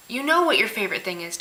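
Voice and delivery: normal talking voice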